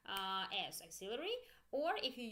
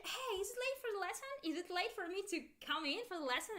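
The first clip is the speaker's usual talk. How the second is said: high, quick student question; pitched-up, hurried asking voice; student asking teacher; 8 semitones higher, faster than usual. Acted. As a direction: play a latecomer checking with the teacher. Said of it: "you come for the lesson and you ask the teacher".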